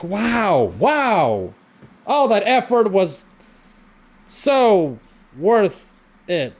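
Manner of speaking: dry mock amazement, drawn out; sarcastic slow praise; sarcastic wow. Real, not acted. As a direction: fake impressed, slow and bitter. Sarcastic wow about wasted effort.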